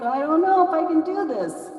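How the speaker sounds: a touch of nervous hesitation; mild doubt. Acted. Hawk doubting he can fetch the fire, mildly fearful. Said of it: "he was still like".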